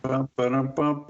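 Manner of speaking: vocal drum rimshot; sung ba-dum-tss joke drum; rimshot sound. Pretend. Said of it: "that's very punny".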